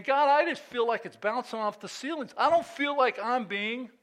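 high-pitched, pleading prayer; crying out to God. Acted. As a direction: voice the psalmist's frustrated cry. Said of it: "He's crying out saying".

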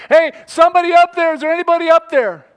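high, loud shout upward; calling out. Acted. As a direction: holler for anyone above to hear. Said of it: "begins to cry out and call out".

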